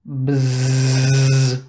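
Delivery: long droning bzzz; buzzing fly. A narrator's reading. Narrator making the fly's noise in the kitchen. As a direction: buzz it like a fat fly. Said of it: "What was that noise".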